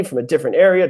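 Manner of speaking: ordinary talk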